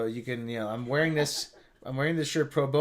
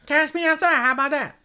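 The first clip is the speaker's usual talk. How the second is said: very high, loud parody squeal; exaggerated high-pitched mocking imitation; mock catchphrase; 14 semitones higher, 7 dB louder than usual. Hammed-up imitation of viral catchphrase.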